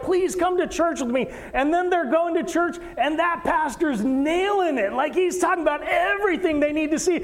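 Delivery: high, loud and urgent, preaching to the congregation; fervent preaching. Acted. Pastor pleading with the congregation, fervent preaching. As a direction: press every point home from the pulpit.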